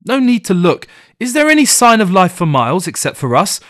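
loud, high, bitter cry; anguished outburst. Acted. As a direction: fling the words out in grief. Grieving wife lashes out.

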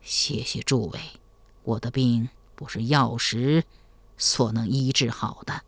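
put-on barely breathing, sick old general; faint weak voice. A narrator's reading. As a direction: speak faintly, as if dying. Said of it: "曹彬气若游丝地说".

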